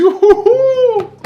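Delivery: very high, slow hooting cry of delight; excited hoots. Retold or imitated. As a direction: hoot with giddy joy. Retold joy at meeting billionaire.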